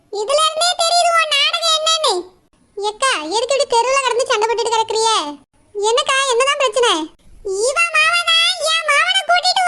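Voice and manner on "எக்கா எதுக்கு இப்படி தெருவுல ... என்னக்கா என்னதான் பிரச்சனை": lower, quicker neighbour woman cutting in; a worried neighbour breaking up the fight. Acted. (சண்டையை நிறுத்த வரும் பக்கத்து வீட்டுப் பெண், தாழ்ந்த குரலில்)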